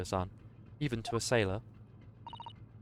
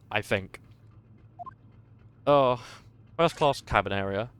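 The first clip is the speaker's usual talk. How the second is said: ordinary talk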